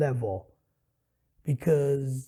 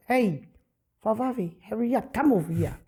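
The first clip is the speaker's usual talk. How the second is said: put-on Samoan Santa accent; 6 semitones higher than usual. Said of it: "used a Samoan accent".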